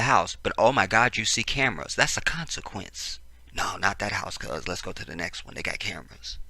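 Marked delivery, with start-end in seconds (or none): 3.5-6.4 low-key, quiet acted aside; hushed thief. Thief to accomplices on seeing cameras at a house, hushed. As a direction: thief steering the crew away, keep it down. Acts out wary thieves at house.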